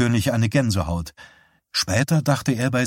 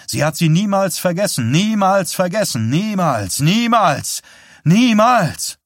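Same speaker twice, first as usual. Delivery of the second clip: rising intensity; 5 semitones higher, 4 dB louder than usual. Acted. Johnny's voice building to climax.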